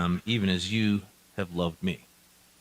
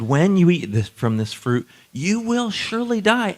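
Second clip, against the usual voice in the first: louder, rising high on die; emphatic warning; 9 semitones higher, 4 dB louder than usual. Retold or imitated. Preacher booming God's warning to Adam and Eve, high and loud. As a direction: hammer the warning home. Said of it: "God said".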